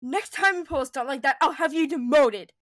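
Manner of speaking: sharp reprimand. Acted. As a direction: snap the threat at him, stern and loud. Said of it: "Leia said sharply".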